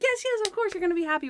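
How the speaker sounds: high, gushing, overeager yes yes; mock gushing Natalie. Natalie humoring Monk about his new house, high and gushing. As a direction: gush it like a friend faking enthusiasm. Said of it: "she's like".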